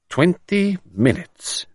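slow, thoughtful musing. Acted. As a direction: turn the number over slowly. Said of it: "mused the commissary".

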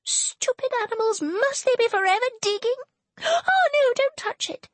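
a hammed-up wail of pain. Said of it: "wailed Serena".

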